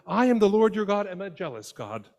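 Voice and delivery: high and loud, a booming divine declaration; God declaring. Retold or imitated. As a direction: announce it to Moses with force. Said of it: "God reminds Moses".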